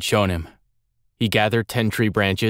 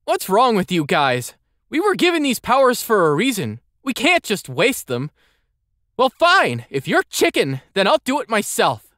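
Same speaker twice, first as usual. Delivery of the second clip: put-on high, indignant boy voice; huffy kid, pitched up; indignant young boy; 13 semitones higher than usual. Andel, frustrated.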